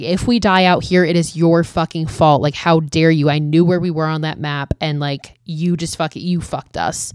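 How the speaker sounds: normal talking voice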